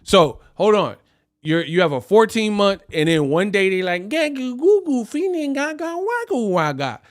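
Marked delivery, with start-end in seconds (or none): 4.1-7.0 put-on high gurgling baby talk; baby babble. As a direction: babble like an infant. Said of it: "they don't know how to talk".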